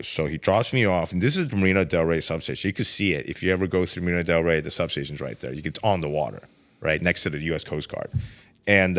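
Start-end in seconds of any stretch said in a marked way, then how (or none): none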